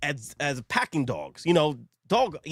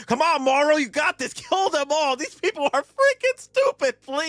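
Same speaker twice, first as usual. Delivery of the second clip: high, loud cheering for the villain; 12 semitones higher, 4 dB louder than usual. Retold or imitated. Viewer urging Morrow to kill everyone, loud high cheering. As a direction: egg him on like a fan in the stands. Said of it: "I was like".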